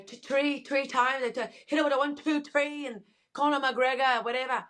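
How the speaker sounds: a quick Irish fight-talk impression; Irish accent. Shown as a demonstration. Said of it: "Irish accent".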